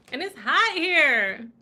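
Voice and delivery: loud, drawn-out whine about heat; slow, emphatic, exasperated outburst; heat complaint. Real, not acted.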